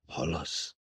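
very soft breathy whisper; hushed plea to his mother. A narrator's reading. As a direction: barely voice it over her face.

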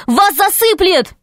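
very high, alarmed cry; warning cry. A narrator's reading. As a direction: shout a warning, voice leaping up in pitch. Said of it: "закричал Пашка". (тревожный крик, очень высоким голосом)

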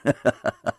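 acted burst of laughter; hearty laugh. A narrator's reading. Mitya bursts out laughing at the card table, acted laugh. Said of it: "Захохотал Митя".

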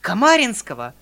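horrified. A narrator's reading. Uncle asks in horror.

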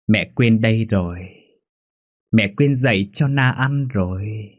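put-on slow, drawn-out childlike call; ghost child calling. A narrator's reading. Ghost child calls for mother.

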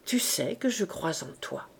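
plus doux, tendre et bas; un aveu tendre à son héros; avec douceur. A narrator's reading. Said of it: "avec une inexprimable douceur". (Grantaire répond à Enjolras, tout doucement)

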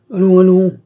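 loud, high burst of impatience; old marshal exclaiming. A narrator's reading. Old marshal scolding his nephew, loud exclamation. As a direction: cry it out like an outraged old duke. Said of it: "s'écria le vieux maréchal".

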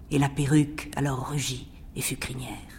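hushed whispered line. Acted. Poet reciting verse, whispering the transformation. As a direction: whisper it like a spell.

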